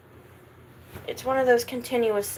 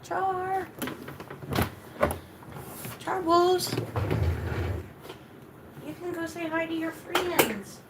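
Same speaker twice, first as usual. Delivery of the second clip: pet talk; 6 semitones higher, 5 dB quieter, slower than usual. Real, not acted. Cooing at the dog.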